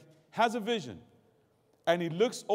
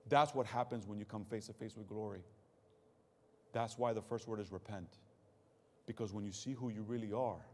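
low, soft and grave; hushed sermon; 9 semitones lower, 10 dB quieter than usual. Real, not acted. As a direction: bring them down to sober reflection.

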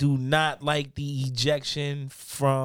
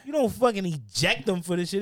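normal talking voice

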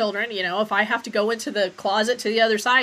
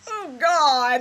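louder, higher "oh God" exclamation; overwhelmed reaction; 6 semitones higher, 7 dB louder than usual. Retold or imitated. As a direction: act out a viewer overcome by the scene. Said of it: "where you're just like".

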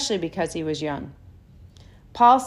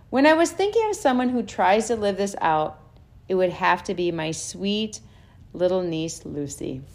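ordinary talk, nothing marked

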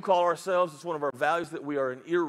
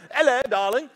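higher, louder put-on British greeting; British accent; 5 semitones higher, 6 dB louder than usual. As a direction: do a hammed-up British "darling". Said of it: "'cause he's British".

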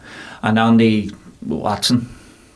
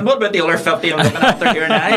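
quick, higher quip through laughter; laughing retort; 8 semitones higher, faster than usual. Retold or imitated. Barman joking back to taxi drivers about his tourists, laughing. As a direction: toss it out while laughing.

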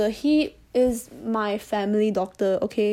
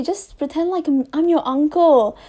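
imitating the doctor; 5 semitones higher, 4 dB louder than usual. Doctor coaxing his patient to relax, mock-friendly higher voice. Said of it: "the doctor came over to me and was like".

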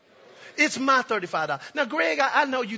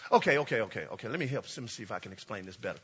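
much lower and softer, calming down; quiet aside; 12 semitones lower, 8 dB quieter, faster than usual. Real, not acted. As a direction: settle the room quietly.

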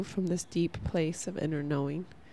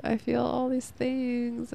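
slow, higher, whiny half-crying voice; mock teary whine; 5 semitones higher, slower than usual. Telling Faraday her feelings, slow mock teary whine. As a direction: drag it out like a tearful kid. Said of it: "I just started crying".

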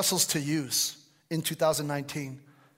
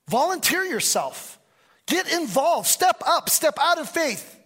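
higher, louder rallying call; preaching exhortation; 10 semitones higher, 5 dB louder than usual. Real, not acted. Pastor urging the church to serve, loud rallying preach. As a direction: push them to step up.

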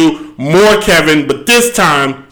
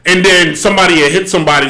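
ordinary talk, nothing marked

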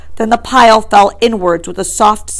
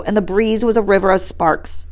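plain reading voice

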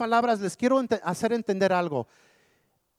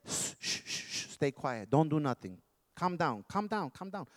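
hushing; 2 semitones lower, 7 dB quieter than usual. Acted. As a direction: hush them down, fading nearly to nothing. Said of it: "He wants to keep us quiet".

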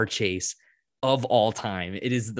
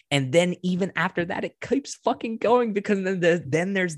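excited; 8 semitones higher than usual. Real, not acted. Excited rush, pitch jumps.

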